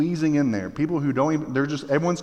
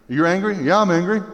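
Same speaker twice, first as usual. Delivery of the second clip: mock angry rioter; 5 semitones higher, 5 dB louder than usual. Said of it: "Like, they're just angry".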